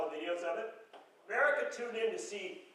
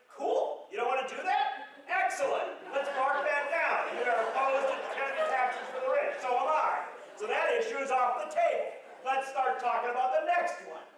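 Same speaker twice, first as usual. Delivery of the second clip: higher, upbeat and unbothered; breezy Biden; 6 semitones higher, 4 dB louder than usual. Retold or imitated. The president cheerfully answers heckling Republicans, upbeat and unbothered.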